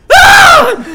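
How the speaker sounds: sudden high shriek of shock; startled scream. Acted. Shopkeeper Gemini shrieks at the spider man. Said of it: "just goes".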